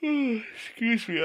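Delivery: sighing through a yawn; tired yawn while talking. Real, not acted. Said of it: "I apologize for the yawning".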